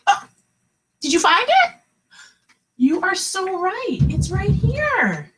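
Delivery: high, bright teacher cheer; excited praise. Real, not acted. Kindergarten teacher cheering her class for finding the word chunk, excited and high. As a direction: burst into praise, pitch way up. Praising kids who found the pattern.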